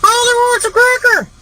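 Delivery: piercingly high talking-bird voice; parakeet squawk. Acted. Hidden parakeet blurts out on the plane, high squawk. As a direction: squawk like a parakeet giving itself away.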